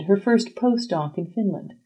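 ordinary delivery

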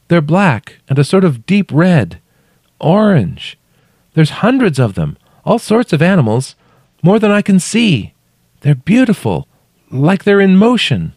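high, loud calls in short phrases; calling up. A narrator's reading. Trapped woman describes paintings, calling up.

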